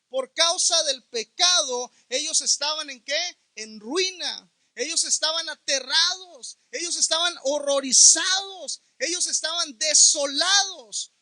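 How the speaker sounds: loud, rising preacher cadence; fiery preaching. Acted. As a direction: build the warning to a pulpit roar. Preaching at full force.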